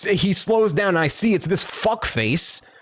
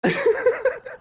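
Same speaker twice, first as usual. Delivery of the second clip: high laugh. Real, not acted. Laughing at his own story.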